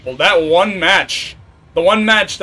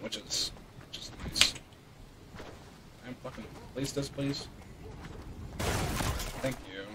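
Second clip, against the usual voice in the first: muttering; 5 semitones lower, 23 dB quieter, slower than usual. Real, not acted. Muttering to himself.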